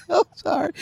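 high giggly sorry; laughing apology. Real, not acted. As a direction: apologize while still laughing.

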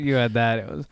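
normal talking voice

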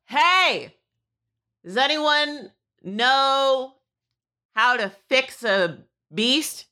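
high, drawn-out call for help, easing down; mock yell starting high, slowing; pretend yell. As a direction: call out to anyone around, stretching the words. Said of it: "and I, like, yell".

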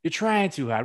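mock Boston accent, "hard" with the r dropped. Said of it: "in a Boston accent".

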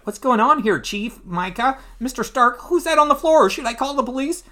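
high, bewildered questioning; Foggy flustered. Acted. Man walking in on the wreckage, high and flustered. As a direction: pile up questions in a high worried voice. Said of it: "Foggy's like".

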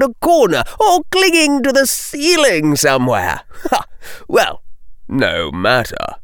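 smug villain toying with cornered prey, pitch swooping high; falsely jovial bully. Acted. Bully Lee lectures cornered girls, taunting and falsely jovial. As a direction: taunt them at leisure with mock cheer.

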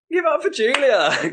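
very high, loud, drawn-out emcee holler; slow, squealed showbiz shout; forced cheer. Acted. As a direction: whip up applause far too brightly. Forced applause call after tearful speech.

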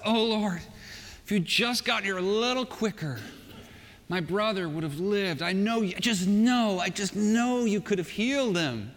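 insistent lament, lightly dramatized; acted pleading. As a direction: play a grieving sister pleading. Grieving sister reproaches Jesus on arrival.

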